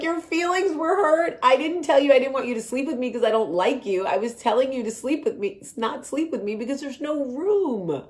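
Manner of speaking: high, loud, incredulous mock outburst; hammed-up bedtime quarrel, high-pitched and insistent; exasperated retold protest. Acted quarrel with husband.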